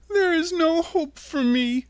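put-on high, despairing girl's voice; sad little girl. A narrator's reading. Dorothy telling her friends her sad news, high and sad. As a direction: say it up high and hopeless. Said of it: "she said sadly".

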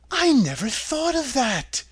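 put-on high, slow girlish delight; a girl thrilled by the idea; joyful Dorothy. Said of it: "said Dorothy joyfully".

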